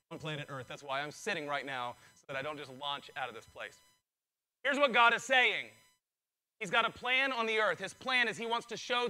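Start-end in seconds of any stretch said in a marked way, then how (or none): 0.1-3.8 low, soft and held back; talking quiet. Real, not acted. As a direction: keep it quiet so you don't launch. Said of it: "This is me talking quiet right now".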